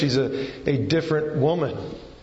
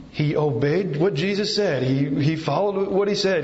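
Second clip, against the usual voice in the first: normal talking voice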